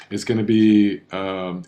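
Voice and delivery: ordinary delivery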